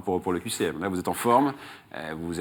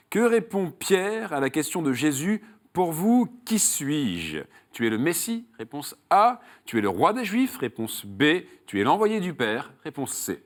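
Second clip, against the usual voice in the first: raised, emphatic game-show host voice, settling on the last option; quiz question read; 4 semitones higher than usual. Acted. Host quizzes a contestant on Saul's road, quiz question read. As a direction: pose the question and lay out each choice.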